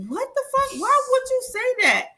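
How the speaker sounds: replaying her stunned fury at the date, high and sharp; outraged disbelief. To the crude date in his car, high outraged disbelief. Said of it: "I was like".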